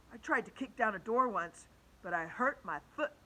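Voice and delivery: ordinary delivery, nothing marked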